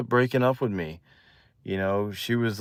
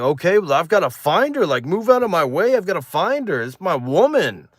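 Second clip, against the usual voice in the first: high-pitched, hammed-up excitement; giddy urgency; 7 semitones higher than usual. Boy rushing off to find new girlfriend, giddy. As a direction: push past everyone, voice swooping up. Retold frantic rush to find her.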